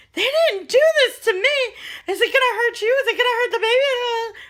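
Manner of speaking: high, loud mock-panicked wail; comically horrified squawk of questions; freaked-out mother-in-law. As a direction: imitate her frantic, hammed-up alarm. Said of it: "freaked out".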